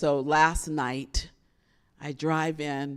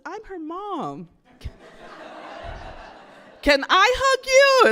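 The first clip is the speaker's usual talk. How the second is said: gushing mom; 9 dB louder, slower than usual. Retold or imitated. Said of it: "her mom said".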